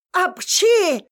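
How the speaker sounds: громкий наигранный чих; чихнуть резко и громко. Acted. Свекровь чихает от пыли. (громкий чих свекрови)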